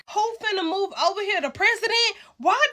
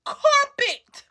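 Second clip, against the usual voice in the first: exasperated; 8 semitones higher than usual. Real, not acted. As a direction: fling the word out in disbelief. Disgusted at apartment.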